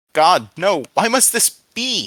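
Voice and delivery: loud, high, anguished plea; scream. A narrator's reading. Captive to God before burning, anguished scream. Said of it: "he screamed".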